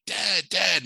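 calling for Dad like it's huge news; excited calling out. Retold or imitated. Kid calls dad to the phone, excited.

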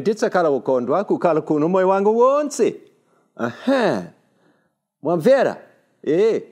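high, loud, animated foreign-language chatter; showing off African language. Acted. Speaker demonstrating interesting languages he imagined learning, animated chatter. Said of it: "languages and things like that".

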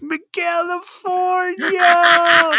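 very high, slow, drawn-out yell of a name; shouted name from afar. Retold or imitated. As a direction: call him across the parking lot, full voice. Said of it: "I hear this".

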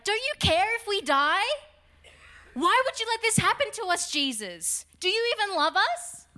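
high, loud, frantic plea; panicked cry. Retold or imitated. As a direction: yell it like sailors sure they'll drown. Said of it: "they turn to Jesus and they're like".

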